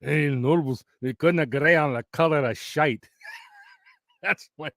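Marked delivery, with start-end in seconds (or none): 0.0-3.1 put-on Braveheart-style Scottish; Scottish accent. Quoting a favorite Braveheart line, Scottish accent. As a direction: do a film Scot with a thick burr. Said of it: "is where the one guy goes".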